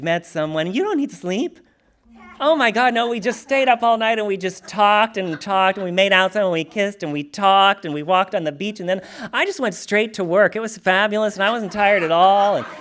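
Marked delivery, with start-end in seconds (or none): 2.4-12.7 louder, higher gush about the night; breathless rave about the date; mock-giddy infatuation, laughing at the end; giddy new lover. Retelling giddy new love.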